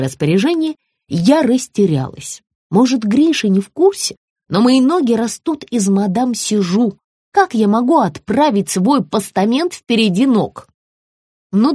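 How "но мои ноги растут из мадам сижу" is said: usual speaking voice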